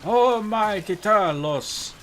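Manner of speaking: high, slow, solemn mock prayer.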